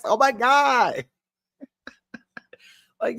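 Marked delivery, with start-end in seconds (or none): 0.0-1.0 high, drawn-out mock cry; imitated yell. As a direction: mimic him in a high, slow wail. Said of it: "He was like".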